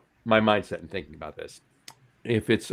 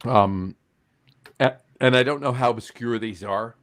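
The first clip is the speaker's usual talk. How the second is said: usual speaking voice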